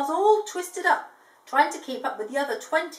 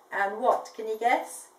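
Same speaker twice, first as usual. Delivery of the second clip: plain reading voice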